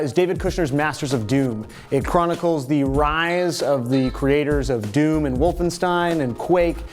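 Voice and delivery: ordinary talk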